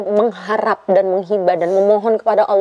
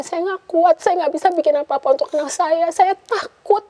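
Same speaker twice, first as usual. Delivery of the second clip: pleading prayer pitched far higher; mother showing how to cry to God, much higher; tearful prayer; 7 semitones higher than usual. Retold or imitated. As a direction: beg God for help, on the edge of tears.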